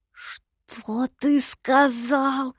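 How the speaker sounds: very slow, drawn-out threat; menacing drawl. A narrator's reading. Night visitor challenging the imp, menacing drawl. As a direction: stretch every word menacingly. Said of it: "угрожающе протянул ночной гость".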